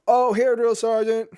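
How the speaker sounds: high, slow startled recruit reply; barked military recruit reply. Retold or imitated. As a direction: answer like a caught-off-guard recruit. Recruit answering late.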